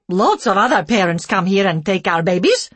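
loud snappy broken English; snapping. A narrator's reading. Care worker snapping at the couple, loud broken English. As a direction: bite back at them. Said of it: "she snapped".

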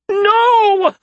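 high, loud screamed no. A narrator's reading. Isaria screams on hearing she is married, high and loud. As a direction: scream it in horror. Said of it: "screamed Isaria".

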